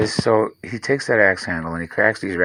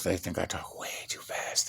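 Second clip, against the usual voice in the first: whisper; 3 semitones lower, 13 dB quieter than usual. Real, not acted. Aside about Florida folks.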